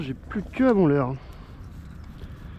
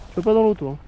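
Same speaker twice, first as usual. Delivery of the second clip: calling to the dog; 3 semitones higher than usual. Real, not acted. Telling the dog to stay out.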